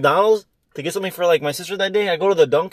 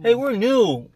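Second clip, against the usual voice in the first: bright put-on newcomer voice, higher; higher mock greeting; 7 semitones higher, much slower than usual. Imagining meeting the neighbors, higher and chirpy. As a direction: greet like a friendly new neighbor. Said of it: "imagined greeting".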